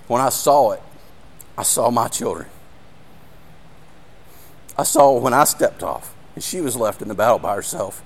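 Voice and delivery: choked up, halting between phrases; getting emotional again. Real, not acted. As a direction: fight back real emotion as the voice drops and cracks upward. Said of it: "I mean, here I go again".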